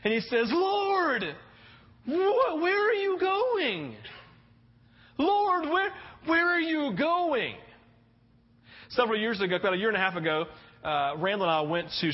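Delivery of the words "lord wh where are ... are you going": much higher, slow, near-crying plea; overwhelmed Peter begging, voice breaking high; anxious, pained plea. Acted. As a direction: cry out to the Lord in pain. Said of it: "in this very anxious, overwhelmed, just this painful voice".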